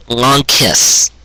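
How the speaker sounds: ordinary delivery, nothing marked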